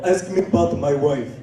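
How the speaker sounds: put-on foreign accent; Abdul. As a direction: prompt them in a thick foreign accent. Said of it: "his name is Abdul".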